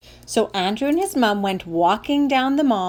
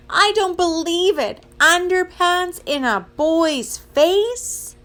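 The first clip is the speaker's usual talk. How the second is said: high, slow, appalled protest; high-pitched, drawn-out incredulity; mortified disbelief; 8 semitones higher, slower than usual. Acted. Mortified child repeats the complaint.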